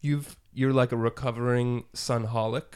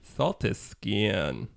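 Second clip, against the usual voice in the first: slow savoring; 3 semitones lower, much slower than usual. Pretend. Slow repeat.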